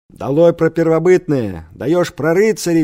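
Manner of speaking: shouted protest slogan; shouted from the corner. A narrator's reading. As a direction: call it out like a rallying cry. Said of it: "закричали из угла".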